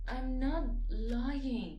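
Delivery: drawn-out, heated insistence; emphatic denial. Acted. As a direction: stretch out the denial, offended. Insisting when accused of lying.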